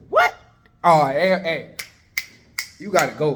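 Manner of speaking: loud, indignant dismissal; king's outburst. Acted. King learning of the gallows, loudly indignant. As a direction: erupt and order him out. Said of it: "he was like".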